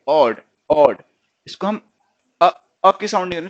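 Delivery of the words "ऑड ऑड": ऑड said loud with an open o; ऑड demo. Shown as a demonstration. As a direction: stress the open o in ऑड. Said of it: "इसको हम क्या read करेंगे?".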